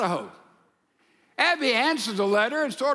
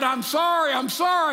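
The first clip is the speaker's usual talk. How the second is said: retold holler, raised pitch; mildly hollered, higher-pitched apology; 5 semitones higher than usual. Startled meter reader apologizing to the woman in the basement, hollering. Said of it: "He hollered".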